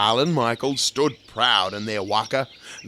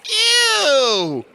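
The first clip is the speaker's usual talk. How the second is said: high, loud grossed-out ew; disgusted; 6 dB louder than usual. A narrator's reading. Wilhelmina disgusted at eating children, high loud ew. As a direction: recoil at the idea. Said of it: "in disgust".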